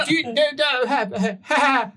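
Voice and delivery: nervous laugh. Acted. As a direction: laugh it off awkwardly.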